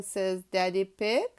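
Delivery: usual speaking voice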